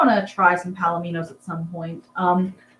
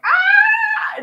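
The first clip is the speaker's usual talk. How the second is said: sudden very high loud screech; parrot scream imitation; 8 dB louder than usual. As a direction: shriek like a startled parrot. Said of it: "he's just like".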